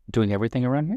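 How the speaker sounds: doing everything around here with a rising question tune; rising mirror. Shown as a demonstration. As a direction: reflect it back as a curious question. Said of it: "use an upward reflection".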